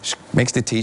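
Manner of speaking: ordinary talk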